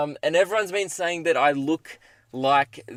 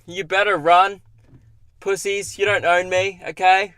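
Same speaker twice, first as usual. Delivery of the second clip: loud, higher mock-tough taunting; cocky taunt; 5 semitones higher, 7 dB louder than usual. Real, not acted. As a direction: shout a brave taunt after them. Taunting the departed cops.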